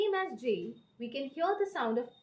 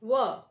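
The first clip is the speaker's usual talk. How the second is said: W as a loud "wuh"; emphatic "wuh" sound; stressed W; 6 dB louder than usual. Shown as a demonstration. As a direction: push out W with round lips. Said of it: "The stress is on".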